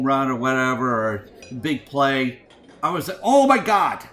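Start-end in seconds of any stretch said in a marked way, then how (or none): 3.2-4.0 loud, higher exclamation of exasperation; mock agony. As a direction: cry out as if the noise hurts. Retold mock complaint about her cheering.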